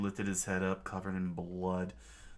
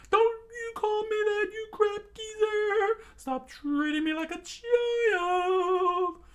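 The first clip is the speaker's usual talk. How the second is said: very high, loud, slowing yell; angry yell; 9 dB louder, slower than usual. Acted. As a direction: rage at the old man. Sanji yells at Zeff.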